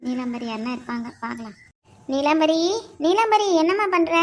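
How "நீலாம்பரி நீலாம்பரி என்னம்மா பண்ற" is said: loud neighbourly call. Acted. Visitor calls neighbour Neelambari out of her house, calling out. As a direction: call out to bring her outside.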